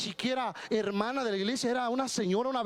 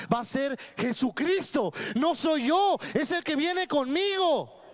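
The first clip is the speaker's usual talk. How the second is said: fiery preaching; 6 semitones higher, slower than usual. Real, not acted. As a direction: build to a shout of triumph over the demon. Preacher rising to climax.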